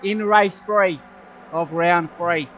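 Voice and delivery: excited race commentary; motorsport commentator. Acted. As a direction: call the race live, building up. Live race play-by-play.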